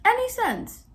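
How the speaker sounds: high, disbelieving repeat; real exasperation; incredulous. Real, not acted. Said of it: "How does that make any sense?".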